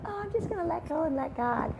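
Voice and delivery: mock airy believer. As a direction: parody a carefree believer. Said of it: "these people, they go".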